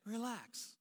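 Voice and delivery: a soft, dismissive "Relax" that grates; annoying relax. Retold or imitated. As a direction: mimic someone brushing off your panic. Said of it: "Don't you wanna punch them in the face when they say".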